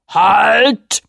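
loud, high bellowed halt; roar. Acted. The sea king stopping the fleeing children, roaring. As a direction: bellow like an angry giant king. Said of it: "Und dann brüllte er".

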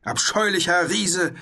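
high, indignant knightly shout; outraged high-pitched roar; bellowing. A narrator's reading. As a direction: bellow a haughty order for silence. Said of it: "brüllte der Ritter".

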